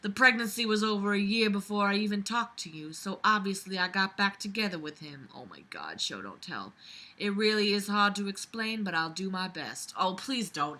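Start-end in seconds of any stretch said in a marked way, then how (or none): 5.3-6.7 exasperated mutter. Real, not acted. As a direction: lower and much softer, a groaning aside. Groaning at bad writing.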